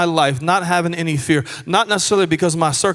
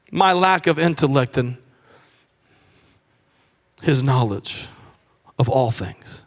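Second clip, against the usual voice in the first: ordinary delivery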